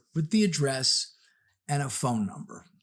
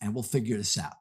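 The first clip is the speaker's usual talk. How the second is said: soothing stranger; 6 semitones lower, 4 dB quieter than usual. Retold or imitated. Calm stranger soothing the woman.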